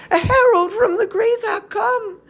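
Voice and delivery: high, crying alarm; dread outcry. Acted. A captive woman announcing the herald, high and crying.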